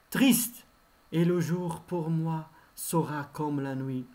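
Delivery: declaimed verse with sustained, measured phrases; poem recitation. Acted. Teacher reciting Hugo's poem to third-year pupils, declaimed verse. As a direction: declaim loftily, letting each stanza's last line fall away.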